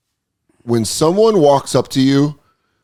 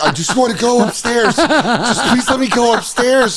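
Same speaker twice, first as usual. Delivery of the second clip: high, laughing mock plea; pleading tenant; 10 semitones higher than usual. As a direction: whine to be let out, laughing. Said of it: "They're like".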